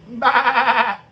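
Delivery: laughing, loud mock bleat; sheep bleat. Mocking fans as sheep with a loud bleat.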